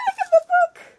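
high, fast, laughing squeal; excited squeal. Real, not acted. Girl spotting the book in Laura's gift, giddy squeal. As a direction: blurt it out in a giddy rush.

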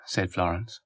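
ordinary delivery

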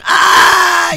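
loud, high mock yell of pain; re-enacted yell. Telling friends about the back spasm, re-enacted yell. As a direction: yell out "ah" as if cramping badly. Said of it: "like, yelling".

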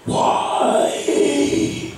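high, breathless crying "Why?"; retold anguished cry of why; groaning cry. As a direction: let "Why?" break out as a sob. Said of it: "I remember that I said this word".

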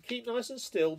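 high, loud little fly voice. Acted. The flies ordering the mole, high and loud. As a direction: squeak it like a bossy fly.

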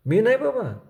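put-on squirming boy's anxious high plea; fidgety refusal. A narrator's reading. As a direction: push नाही up high, begging to be let off. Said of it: "चुळबुळ करीत बाळ्या म्हणाला".

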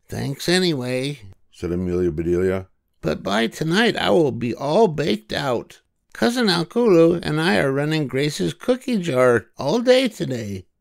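high, cheerful storybook voice for Amelia; Amelia Bedelia voice. A narrator's reading. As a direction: lift into a bright girlish character voice.

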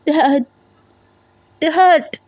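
high, falling cries of Dad through sobs; sobbing cries. Acted. Daughter calls unconscious father, acted sobbing cries. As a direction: call out to father, breaking down. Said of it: "వెక్కి వెక్కి ఏడుస్తూ".

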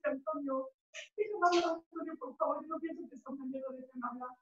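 soft, high, strained plea; small, high, quiet voice; hushed pleading. Acted. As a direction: plead under the shouting. Pleading quietly after his outburst.

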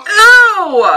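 drawn-out "ew" swooping up and down; disgusted groan. Real, not acted. Viewer grossed out by the film, disgusted "ew". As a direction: groan out your revulsion.

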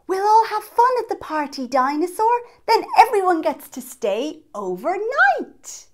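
high, loud, quick and eager; excited child. Acted. Child telling Dinosaur about the party, excited.

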